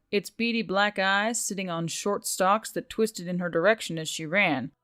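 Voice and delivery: ordinary delivery, nothing marked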